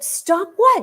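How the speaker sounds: high, startled question; imitating her own stunned reply; shocked mom. Mom caught off guard by her daughter, high and startled. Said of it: "I was so shocked".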